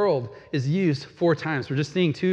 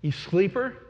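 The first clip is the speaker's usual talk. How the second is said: higher, rising jab at the sleeper; the captain taunting Jonah, mildly raised and rising; mock scolding captain; 6 semitones higher than usual. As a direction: needle him with the word sleeper.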